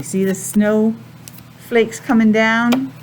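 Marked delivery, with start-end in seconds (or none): none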